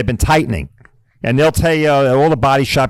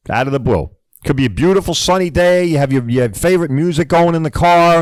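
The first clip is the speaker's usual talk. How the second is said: ordinary talk, nothing marked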